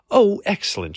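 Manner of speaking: high, loud "oh" of relief; relieved declaration. A narrator's reading. Lady hears Hamish understands, relieved high declaration. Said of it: "she declared and blew out a sigh".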